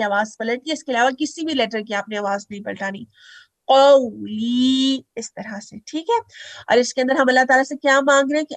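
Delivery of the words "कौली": कौली drawn out slowly, not rushed; slow qauli. Shown as a demonstration. Teacher showing students not to rush कौली. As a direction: take your time on कौली. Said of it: "बहुत जल्दी नहीं कहते".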